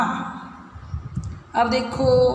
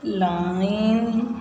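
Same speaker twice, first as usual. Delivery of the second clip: slow model word; much slower than usual. Shown as a demonstration. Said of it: "teacher models word".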